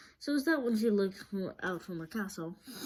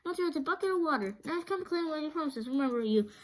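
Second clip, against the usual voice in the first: put-on eager girl voice; 8 semitones higher, 3 dB louder, faster than usual. A narrator's reading. Dorothy demanding the promise.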